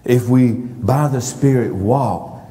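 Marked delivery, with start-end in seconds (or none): none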